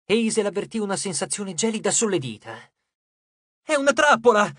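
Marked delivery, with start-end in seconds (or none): 3.6-4.5 loud, high warning cry; urgent, pitched well up; alarmed exclamation. A narrator's reading. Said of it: "esclamò".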